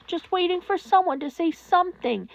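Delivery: usual speaking voice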